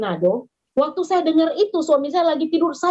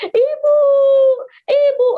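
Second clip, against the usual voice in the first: high, loud imitated cry for mother; mock child's scream; 12 semitones higher, 6 dB louder, slower than usual. Beaten child screaming to the mother over the phone. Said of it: "Anak itu teriak begini".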